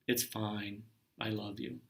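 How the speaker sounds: soft, tender reassurance; gentle self-comfort. Retold or imitated. Speaker comforting own self-doubt, soft and tender. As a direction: soothe yourself quietly. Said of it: "was just like".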